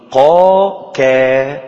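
drawn-out articulation drill on قا and كا; qaf against kaf. Shown as a demonstration. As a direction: slowly sound out قا then كا to show where each is made. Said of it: "لو قلت معي الآن".

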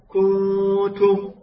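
"كنتم" بضمة قصيرة على الكاف وغنّة ممدودة على النون; رتّل "كنتم" ببطء دون مدّ ضمة الكاف; ضمّة قصيرة مع غنّة. Shown as a demonstration. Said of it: "هكذا تقرأ". (تلاوة "كنتم" بضمة غير مشبعة ونون مغنونة)

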